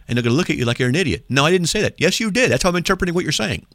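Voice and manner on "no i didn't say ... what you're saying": high, quick mock bickering; acted quarrel. Host acts out arguing with the Bible doubter, mock insistent. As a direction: pitch it up, trading contradictions. Acts out a back-and-forth argument.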